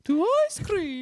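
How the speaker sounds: very high, slow mock British lady; loud falsetto British woman, drawn out; British woman accent. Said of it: "a woman with a British accent".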